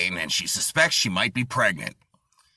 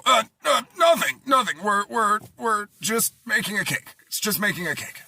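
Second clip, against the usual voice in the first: flustered excuse; 8 semitones higher than usual. Acted. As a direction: stammer like you've been caught. Caught couple making an excuse.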